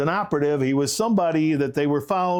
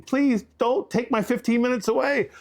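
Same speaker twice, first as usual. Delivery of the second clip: higher, put-on whining plea; mock whiny plea; 9 semitones higher than usual. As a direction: mimic a has-been begging to stay famous. Mocking [name] clinging to fame.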